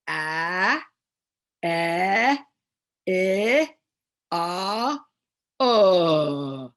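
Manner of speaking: short a, e, i, o, u held steady one by one; long, isolated holds of the short vowels, growing loud. Shown as a demonstration. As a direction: sustain each short vowel sound without a glide. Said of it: "And the short vowels".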